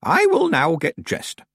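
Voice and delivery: put-on high, slow old witch. A narrator's reading. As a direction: speak as a stiff old witch. Old witch Miss Treason speaks.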